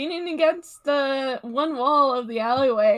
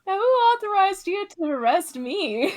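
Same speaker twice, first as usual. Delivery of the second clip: higher and louder, mocking the kid; mocking cocky thief; 8 semitones higher, 6 dB louder than usual. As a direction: scoff at the boy's authority. Thief mocks Rye's threat.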